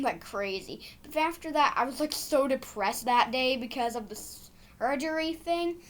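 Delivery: ordinary delivery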